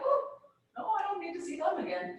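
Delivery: politely recoil, as if giving a bad review; acted-out thumbs-down in a small high voice; unimpressed review.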